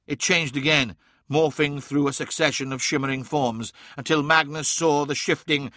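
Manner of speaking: ordinary delivery, nothing marked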